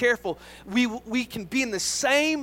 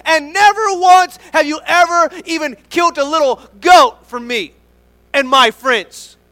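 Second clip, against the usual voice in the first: louder and higher, wounded and indignant; frustrated outburst; 5 semitones higher, 10 dB louder than usual. Acted. Older brother protesting the feast to his dad, frustrated shout. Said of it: "in his frustration".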